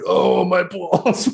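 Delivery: high, slow, drugged-up groan; groggy post-surgery moan. Pretend. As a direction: whine it like a doped-up patient. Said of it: "I'll just be like".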